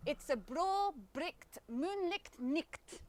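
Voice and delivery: 'bro bricht moonlicht nicht' with rolled r and throaty ch, slow; slow careful 'bricht moonlicht nicht', ch from the throat; Scots tongue twister. Shown as a demonstration. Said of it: "Can you say that with me".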